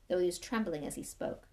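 ordinary delivery

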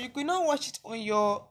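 ordinary talk, nothing marked

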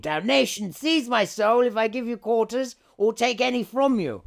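mock scream. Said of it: "he screamed".

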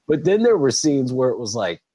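ordinary delivery

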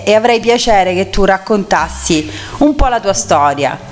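ordinary talk

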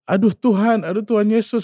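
high-pitched retold cry to God; lightly voiced distraught plea; retold lament. Mother calling on God after her husband's death, mildly voiced lament.

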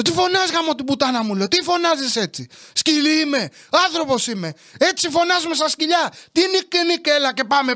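high-pitched indignant rant; exasperated outburst. Retold or imitated. Son scolding mother for yelling, indignant outburst. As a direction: blow up in exasperation, pitch climbing.

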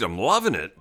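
low, swooping delivery; relishing it in a swooping low voice. Real, not acted. Sets up a jingle quote.

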